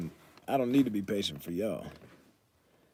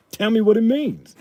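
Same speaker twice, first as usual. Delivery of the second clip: much higher, louder mock insistence; playful demand; 13 semitones higher, 12 dB louder than usual. Pressing Stephanie Renee to explain, playful loud demand. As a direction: pretend to demand the answer.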